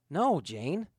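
usual speaking voice

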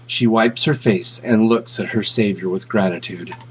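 usual speaking voice

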